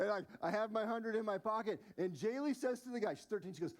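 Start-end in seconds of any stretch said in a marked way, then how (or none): none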